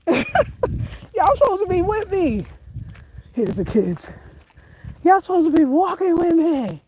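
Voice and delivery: complaining that friends abandoned her; mock complaint. Real, not acted. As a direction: call out to friends who left. Calling out to absent friends.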